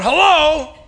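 loud, high acted yell into the phone. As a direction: yell it like an irate man answering. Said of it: "He yelled into the phone".

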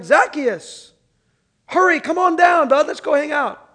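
bright, loud call up into a tree. Retold or imitated. Jesus calling up to Zacchaeus in the tree, loud and friendly. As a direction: call him down like an old buddy.

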